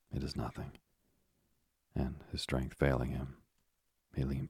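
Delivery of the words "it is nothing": weak whisper. Acted. Ailing father reassures son.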